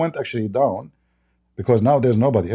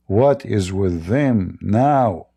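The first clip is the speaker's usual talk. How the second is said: slow emphatic reading; 4 semitones lower, much slower than usual. Shown as a demonstration. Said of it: "slow emphatic reading".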